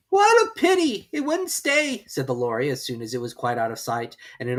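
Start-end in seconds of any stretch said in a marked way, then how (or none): 0.1-2.0 high, loud bird regret; Lory voice. Acted. Lory watching the mouse leave, high. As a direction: sigh with pity.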